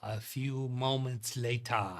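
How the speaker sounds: put-on slow, grand French-accented title-card voice; a playful, slowed French time-card read; mock French narrator. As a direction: announce it like the cartoon's French narrator. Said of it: "What accent is that meme?".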